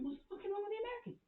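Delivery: high, soft incredulous question; quiet high-pitched disbelief; puzzled protest. Retold or imitated. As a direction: ask it in a small, high voice. Puzzled retort to dad, acted.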